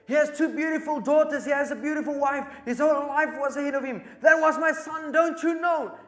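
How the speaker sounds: the general sobs out his grief at the nurse's feet; anguished, high, tearful outcry; weeping. Retold or imitated. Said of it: "starts weeping".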